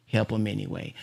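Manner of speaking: a soft, fading repeat of the plea; fading heart voice. Retold or imitated. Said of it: "But my heart says".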